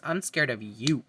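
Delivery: normal talking voice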